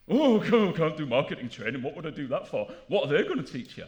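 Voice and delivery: a touch of laughing disdain; scoffing marketer. Retold or imitated.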